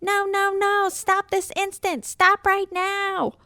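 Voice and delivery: frantic cat screech. Acted. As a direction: screech at the noisemakers. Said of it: "Merle screeched".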